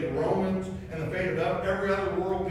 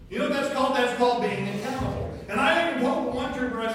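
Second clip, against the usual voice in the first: louder, higher, hammering the lesson; emphatic preaching; 8 semitones higher, 7 dB louder than usual. Real, not acted. Preacher telling the congregation about accountability, emphatic. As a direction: preach it out to the room. Preacher driving home the point.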